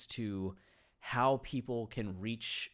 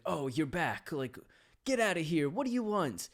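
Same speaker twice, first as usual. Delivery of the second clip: higher, louder, fed-up dismissal; annoyed coworker; 7 semitones higher, 4 dB louder than usual. Retold or imitated. Coworker telling the returning kid to leave, irritated and higher. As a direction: shoo away the kid who keeps coming back.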